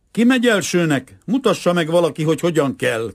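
high, loud demand; anxious shout. A narrator's reading. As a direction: call for someone to show how. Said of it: "kiáltotta egy hosszú, szemüveges német fiú".

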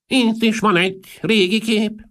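put-on very high, loud, slow old voice; Marchese. A narrator's reading. Old Marchese showing guests an old picture, high slow voice. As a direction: pipe it slowly, an eccentric old aristocrat. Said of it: "mondta Marchese közömbösen".

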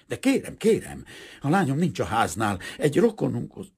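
high, loud, pleading protest; flustered protest. A narrator's reading. Father seized by the angry suitor, flustered and protesting. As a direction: plead urgently, voice jumping up.

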